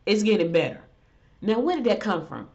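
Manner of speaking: usual speaking voice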